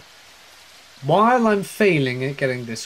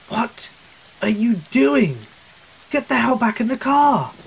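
yelling; 8 semitones higher than usual. Retold or imitated. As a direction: shout it in sudden alarm. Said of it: "Kathy yelled".

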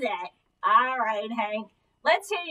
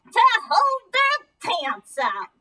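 squeaky ventriloquist dummy; 7 semitones higher, 5 dB louder than usual. Acted. Dummy gives silly answer.

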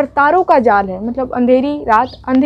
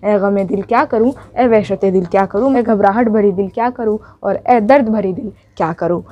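poem recitation; 3 semitones lower than usual. Acted. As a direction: recite it like a lament to your own heart. Recites the poem's refrain.

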